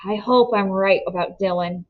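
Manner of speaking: ordinary delivery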